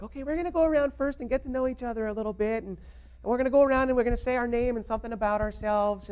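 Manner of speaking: high, sweet teacher voice; lilting nice-teacher impression. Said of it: "was like".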